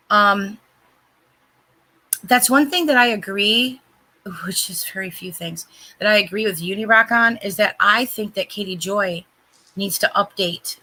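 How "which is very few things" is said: breathy whisper, half to herself; whispered aside. Real, not acted. To the hosts, sharing an aside in a whisper.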